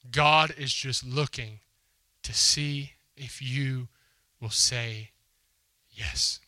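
very slow and deliberate, fading to a breath on yes; slow emphatic repeat. Real, not acted. Preacher to his congregation, slow and deliberate. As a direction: drag each word out for weight.

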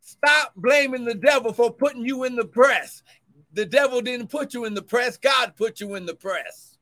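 loud, rising sermon cadence; fervent preacher building; preaching at full force. Acted. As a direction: drive the point home to the church. Preaching rebuke.

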